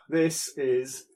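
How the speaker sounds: ordinary talk, nothing marked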